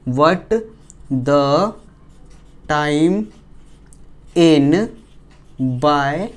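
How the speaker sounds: word by word with long pauses; slow dictation. Real, not acted. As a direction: dictate slowly, one word at a time. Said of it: "मैं slow slow बोलूँगा". (धीमे, शब्द-दर-शब्द बोलकर लिखवाना)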